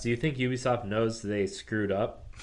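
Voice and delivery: ordinary talk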